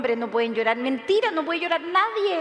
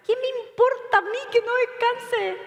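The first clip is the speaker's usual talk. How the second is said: indignant retort; 9 semitones higher than usual. Real, not acted. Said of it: "indignant rebuttal of the mourners".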